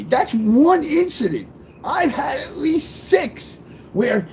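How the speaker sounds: holding back happy tears, slow; happy cry. Real, not acted. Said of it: "it's a happy cry".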